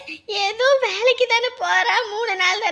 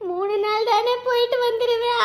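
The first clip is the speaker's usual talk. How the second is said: usual speaking voice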